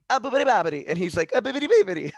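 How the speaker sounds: high, loud cartoon Italian gibberish; Peter Griffin fake Italian. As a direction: ham up the Family Guy Italian bit. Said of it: "Peter Griffin in the Italian restaurant".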